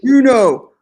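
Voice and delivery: loud, high shout; yelling. Acted. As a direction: yell it out. Mock yell repeated.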